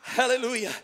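loud, high, building call to the congregation; preaching at full force. Real, not acted. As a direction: preach it out over the music.

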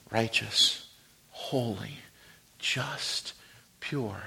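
hushed awe. Acted. Preacher hushing as he reads the new sign above heads. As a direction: let each word fall soft and reverent.

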